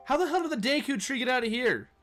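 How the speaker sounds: high, loud, fast disbelief; rapid, high-pitched incredulity; astonished outburst. Real, not acted. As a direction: blurt it out in real amazement. Astonished the Deku tree is here.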